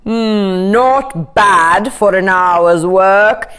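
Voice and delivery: slow, self-satisfied king; pleased king. A narrator's reading. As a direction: savour your own cleverness slowly.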